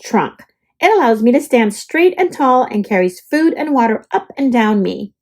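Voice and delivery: ordinary delivery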